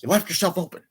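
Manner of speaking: high-pitched imitated villain jibe; hammed-up gloating movie quote, pitched well up; mock taunt. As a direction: toss out a high, mocking taunt. Acting out Robotnik's taunt.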